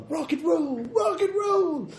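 high, stretched squeaky voice; drawn-out falsetto rock and roll; silly rock cheer. Acted.

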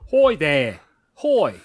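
"Hoy" with an oy vowel in place of hi; Australian "hi". Acted. Trying out an Aussie greeting, exaggerated "Hoy". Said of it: "Is that how you'd say hi?".

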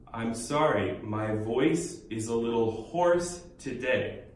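very slow, careful teaching read; slow, clear English demo; slow model sentence. Shown as a demonstration. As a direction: lay it out slowly as a summary. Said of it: "원어민 많이 쓰는 표현".

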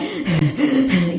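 heavy acted puffing; out-of-breath panting. Asked if she's okay after the stairs, panting hard. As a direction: pant instead of answering. Too winded to answer are you okay.

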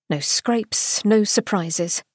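plain reading voice

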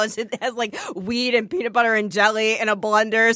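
usual speaking voice

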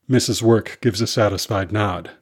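plain reading voice

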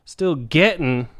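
usual speaking voice

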